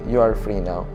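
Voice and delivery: ordinary talk, nothing marked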